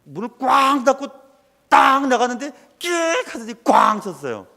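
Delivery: storyteller hitting the climax; dramatic excited telling. Retold or imitated. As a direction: punch the crash sounds. Dramatic car crash story.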